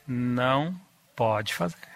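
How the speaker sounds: steady no without any yelling; with firmness. Shown as a demonstration. Mother telling her son no, quietly firm. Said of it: "Com firmeza".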